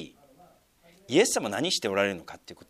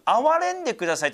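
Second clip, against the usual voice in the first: crying out; 11 semitones higher than usual. Retold or imitated. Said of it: "と叫んでます".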